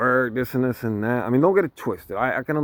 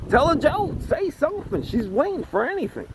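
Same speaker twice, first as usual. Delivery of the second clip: urging; 14 semitones higher than usual. Pretend. Urging the guy on the date.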